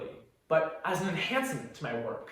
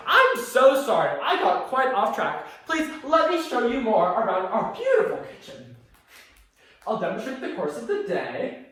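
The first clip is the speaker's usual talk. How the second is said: suddenly loud, raised host voice; brisk hosting apology; 4 semitones higher, 4 dB louder than usual. Acted. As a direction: shake off the memory and project to the guests. Snaps back to hosting after grim memory.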